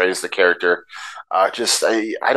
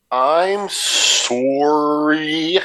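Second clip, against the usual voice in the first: higher, drawn-out whiny apology in a put-on voice; James McAvoy impression; 6 semitones higher, much slower than usual. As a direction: stretch it out like a pitiful office drone. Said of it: "James McAvoy from Wanted is in the room".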